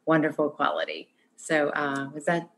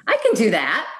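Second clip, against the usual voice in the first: optimistic; 4 semitones higher, 5 dB louder than usual. Shown as a demonstration. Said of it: "what was the emotion I was conveying".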